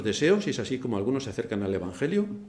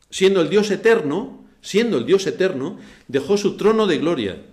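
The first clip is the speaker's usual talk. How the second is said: loud, high impassioned sermon; fervent preaching; 5 semitones higher, 9 dB louder than usual. Acted. Pastor exhorting the congregation, loud and fervent. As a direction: preach it with rising fire.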